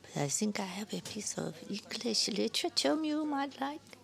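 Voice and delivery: soft whisper, rising at the end. Acted. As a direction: lean in and whisper the offer. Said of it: "She lowered her voice to a whisper".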